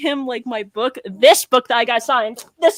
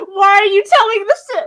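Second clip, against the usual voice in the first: flustered; 8 semitones higher, 8 dB louder than usual. Retold or imitated. Retold flustered question.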